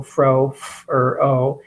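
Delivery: "fro" sounded out as f, er, o with gaps; stretched-out sounds. Shown as a demonstration. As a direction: break "fro" into separate slow sounds. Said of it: "you stretch out those sounds".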